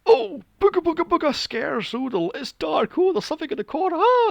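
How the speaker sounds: very high, loud hammed-up spooky voice ending in a scream; mocking cheap horror. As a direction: send up cheap jump scares. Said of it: "It's all".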